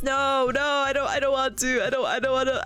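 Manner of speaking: ordinary delivery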